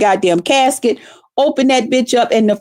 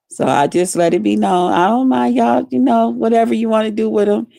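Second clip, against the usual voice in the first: usual speaking voice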